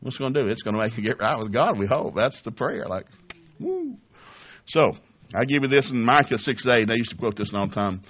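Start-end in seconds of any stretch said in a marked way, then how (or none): 3.6-4.0 high woo. Real, not acted.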